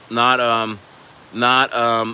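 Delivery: ordinary talk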